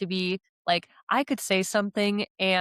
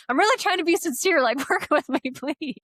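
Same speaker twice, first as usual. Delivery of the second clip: high, quick, laughing appeal; hammed-up desperate pleading; exasperated plea; 8 semitones higher, 3 dB louder than usual. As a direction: plead your sincerity, pitched way up. Acted plea against being misread.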